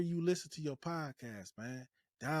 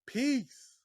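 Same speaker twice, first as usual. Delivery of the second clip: peace said much higher; 10 semitones higher, 3 dB louder than usual. Shown as a demonstration. Host showing listeners how to sign off, high. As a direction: toss a high sing-song peace out. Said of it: "That's how you do it".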